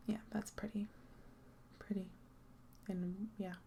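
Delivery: breathy whisper trailing off; whispered aside. Real, not acted. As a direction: whisper it to yourself. Muttered aside to herself.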